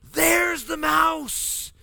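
high, slow, drawn-out frightened cry; frightened wife. Retold or imitated. Husband voicing his wife spotting the mouse. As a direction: stretch it out like she's spotted the mouse. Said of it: "She's like".

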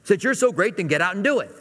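put-on dad daring the kids; exasperated dad. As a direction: toss it back like a fed-up father. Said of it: "how a dad would respond".

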